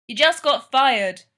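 called back, slow and loud; calling out. A narrator's reading. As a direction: call it down the hall. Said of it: "Mikey calls back".

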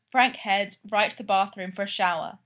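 ordinary delivery, nothing marked

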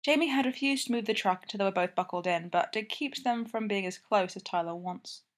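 ordinary delivery, nothing marked

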